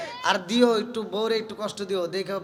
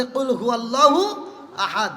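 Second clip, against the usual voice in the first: ordinary delivery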